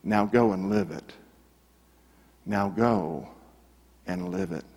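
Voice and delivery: soft, low, solemn words with long pauses; gentle commission. Retold or imitated. Jesus to the rescued man in the light, gently solemn. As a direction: send him off quietly and tenderly, as retold.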